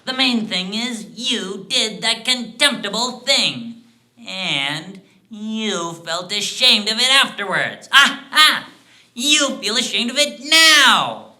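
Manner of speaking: high, loud crowing reproach; scornful accusation. Acted. Dwarf shames the man for a contemptible thing, scornfully. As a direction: rub the shame in, building to a loud finish.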